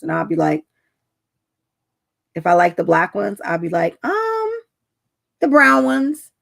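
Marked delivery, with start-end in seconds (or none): 4.0-6.3 coy mock answer. Playing coy picking opposite shoes.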